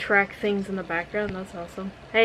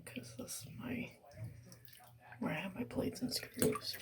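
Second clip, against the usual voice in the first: whisper; 11 dB quieter than usual. Real, not acted. Whispering about leg pain.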